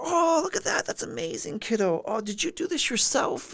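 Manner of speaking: high, gushing over-the-top praise; pandering praise. Retold or imitated. Adult gushes over a kid's drawing, high and pandering. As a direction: play up the delight like it's a show. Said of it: "pandering a little bit".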